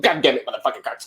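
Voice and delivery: fast, squawked mock tic outburst; Tourette's outburst. Driver imitating his helper suddenly cursing, mock Tourette's outburst. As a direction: imitate a sudden involuntary burst of swearing, rattled off fast. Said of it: "he just breaks out with".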